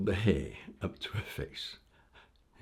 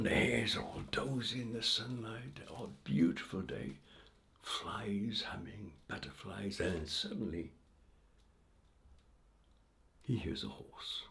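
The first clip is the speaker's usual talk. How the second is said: hushed narration; 8 dB quieter than usual. A narrator's reading. Quiet sunny scene narrated.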